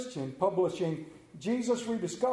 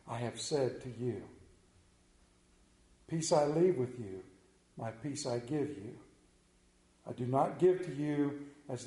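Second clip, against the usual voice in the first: measured, low-key reading with long pauses; scripture reading; 4 semitones lower than usual. Acted. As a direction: read it gently, slowing and softening towards the end. Reads Jesus' words from scripture.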